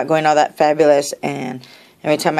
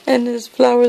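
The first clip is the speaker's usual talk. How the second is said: usual speaking voice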